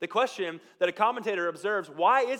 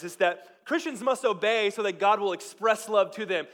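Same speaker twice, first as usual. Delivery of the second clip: normal talking voice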